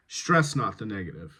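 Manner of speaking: "Stress" emphasised, "not" lighter; stress on the verb. Shown as a demonstration. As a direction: lean on "Stress", let "not the negative" drop. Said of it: "put the emphasis on the verb".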